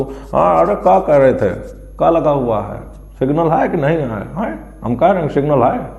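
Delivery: mock rustic passenger with a tobacco-stuffed mouth; tobacco-chewing passenger. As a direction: play a nosy bystander talking round chewing tobacco. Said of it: "कोई एक पीछे ऐसे लगा के तंबाकू".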